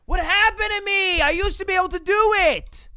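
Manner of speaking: high, loud frustrated wail; exasperated, so mad. Real, not acted. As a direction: wail at losing the knack. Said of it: "I'm so mad".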